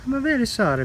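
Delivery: sing-song high sorry. Real, not acted. Gardener apologizing to a baby bunny, high baby talk. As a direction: coo it up high like to a pet.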